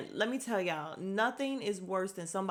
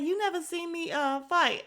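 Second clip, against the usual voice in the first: higher, defiant boast; defiant Drew; 9 semitones higher, 3 dB louder than usual. Retold or imitated. Drew answering Ralph, a high defiant boast. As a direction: claim it boldly, higher. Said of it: "She's like".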